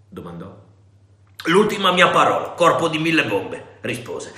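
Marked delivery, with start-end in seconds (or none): 1.5-3.8 defiant. Acted. Silver's defiant final word.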